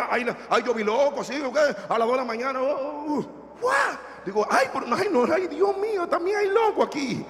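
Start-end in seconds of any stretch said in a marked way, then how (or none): 2.6-3.7 startled shout. Retold or imitated. Retelling a fright at 2 a.m. 4.5-7.2 much higher, alarmed cry; retelling his panic in a high voice; mock alarm.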